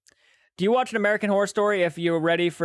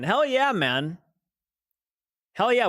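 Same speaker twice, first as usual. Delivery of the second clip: excited; 5 semitones higher, slower than usual. Real, not acted. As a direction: cheer him on, pumped. Hyped welcome for returning viewer.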